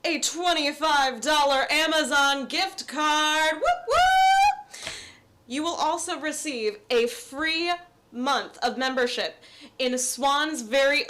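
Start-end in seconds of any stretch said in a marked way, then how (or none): 3.5-4.9 high, loud whoop of excitement; excited cheer. Real, not acted. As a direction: cheer it like a game show host. Cheering about prize gift card.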